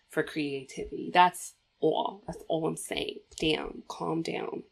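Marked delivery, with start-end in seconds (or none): none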